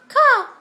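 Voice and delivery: one high, loud crow call; crow caw. Shown as a demonstration. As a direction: caw once like a crow to prompt the dog. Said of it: "What's the crow say?".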